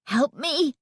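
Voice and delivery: high-pitched scared pleading; shrill, frightened plea. A narrator's reading. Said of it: "pleaded in a shrill, frightened voice".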